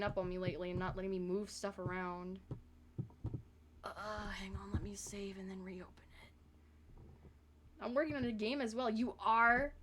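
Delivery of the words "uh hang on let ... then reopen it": hushed, slow whisper to oneself; whispered aside. Real, not acted. Streamer saving before a restart, whispered aside. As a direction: murmur it without voice, half to yourself. Muttering while fixing the software.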